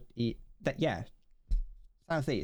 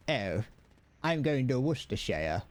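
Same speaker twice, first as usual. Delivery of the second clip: ordinary talk, nothing marked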